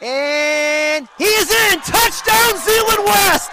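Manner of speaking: big rising touchdown shout; touchdown call. Real, not acted. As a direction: yell the score with full excitement. Touchdown on the goal-line sneak.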